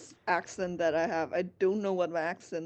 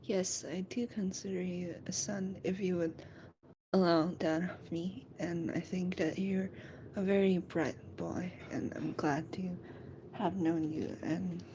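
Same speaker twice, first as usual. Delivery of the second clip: soft, half-whispered tender reply; soft fatherly whisper to Camille; tender, smiling; 7 dB quieter than usual. Acted. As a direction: gently reassure him, smiling. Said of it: "smiling goes".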